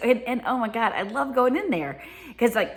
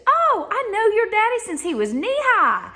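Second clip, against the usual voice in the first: put-on old Southern lady; 13 semitones higher, 5 dB louder than usual. Lady in the grocery store greets the speaker, high and loud. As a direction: play the grocery-store lady who knew your daddy. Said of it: "She said".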